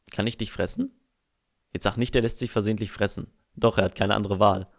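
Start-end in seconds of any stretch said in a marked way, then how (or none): none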